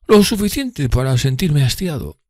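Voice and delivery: slow, high, affected weariness; voicing Drumley as feigned-bored; feigned boredom. A narrator's reading. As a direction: act bored through a yawn. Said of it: "aparentaba bostezar".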